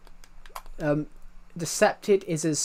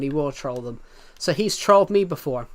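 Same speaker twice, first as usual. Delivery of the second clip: ordinary delivery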